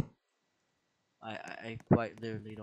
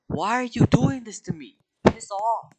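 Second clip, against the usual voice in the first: exasperated shout; 11 semitones higher, 10 dB louder than usual. Real, not acted. Being dragged by game thing.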